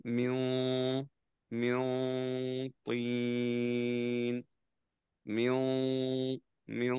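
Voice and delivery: teacher modelling ikhfa on মিন তিন; held nasal demo. Shown as a demonstration. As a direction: hold মিউ and তিন with the নুন humming through the nose. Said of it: "এইভাবে ধরে রাখবো কিছুক্ষণ".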